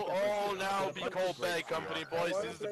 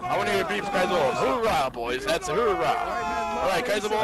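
loud hoorah to the troops; rallying shout; 7 dB louder, faster than usual. Real, not acted. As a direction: shout it like a field officer.

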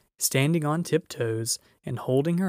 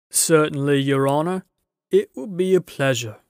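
loud, willing giant voice; a big servant glad to help; obliging giant, louder; eager giant; 3 semitones higher, 7 dB louder than usual. A narrator's reading. Giant voice in story.

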